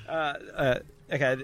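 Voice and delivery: normal talking voice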